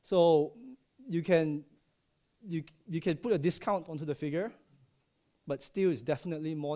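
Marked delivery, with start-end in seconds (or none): none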